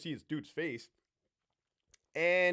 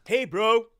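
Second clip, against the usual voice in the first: higher, louder bro-ish greeting; 6 semitones higher, 10 dB louder than usual. Retold or imitated.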